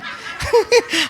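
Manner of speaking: high, bright burst of laughter. Real, not acted. Laughing at lottery joke.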